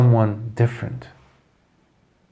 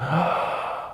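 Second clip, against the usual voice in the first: a sharp breathy gasp of shock; 3 semitones higher, 8 dB quieter than usual. Retold or imitated. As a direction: students gasping at the blow. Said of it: "They're like".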